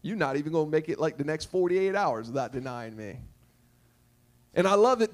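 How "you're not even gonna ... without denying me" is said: low-pitched, understated reply to a follower; lightly voiced Jesus, dropped low; knowing Jesus. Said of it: "And Jesus says".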